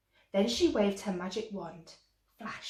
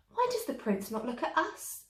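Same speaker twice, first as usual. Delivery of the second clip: jealous stepsisters; 8 semitones higher than usual. A narrator's reading. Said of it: "they cried".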